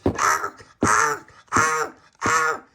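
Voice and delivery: loud yelps of pain; pained cry. Acted. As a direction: howl while the hammer knocks. Tony hurting as bricks knocked.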